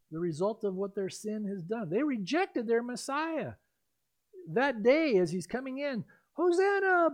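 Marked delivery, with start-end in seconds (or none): 6.3-7.1 crowd cheer. Retold or imitated. Crowd cheering at triumphal entry.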